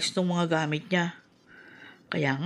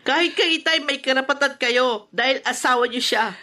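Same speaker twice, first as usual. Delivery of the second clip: louder and higher, pressing hard; heated insisting; 6 semitones higher, 4 dB louder than usual. Acted. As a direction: argue her case with rising heat. Daughter insists on mother's rights.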